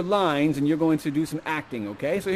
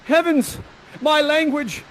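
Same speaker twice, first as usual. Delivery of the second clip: high, loud astonished exclamation; Ferdinand; 9 semitones higher, 5 dB louder, slower than usual. Acted. Ferdinand hearing the young lady speak, high loud amazement. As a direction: burst out in theatrical amazement. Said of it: "No, he says".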